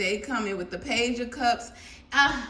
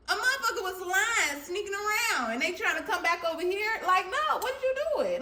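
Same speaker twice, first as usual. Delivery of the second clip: indignant sass; 7 semitones higher than usual. Real, not acted. Indignant high talk.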